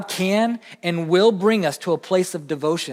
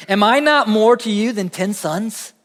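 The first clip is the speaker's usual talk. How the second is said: mock husband; 5 semitones higher, 5 dB louder than usual. Elkanah's clincher line.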